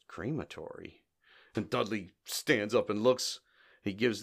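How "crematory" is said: soft, low echo of one word; mimicked Curly aside. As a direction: drop low and quiet, as if from under a sheet. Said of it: "From under the sheet, Curly says".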